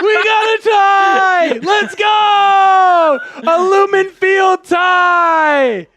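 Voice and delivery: high, loud, drawn-out victory yell; ecstatic shout. Real, not acted. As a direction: scream it to the whole stream, slowly. Celebrating a tied game.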